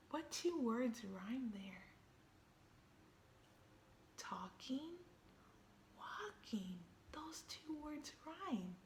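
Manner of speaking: soft whisper, like sharing a secret; whispered rhyme quiz. Acted. Reader quizzing children on the rhyme, whispering. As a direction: hush it to the little listeners.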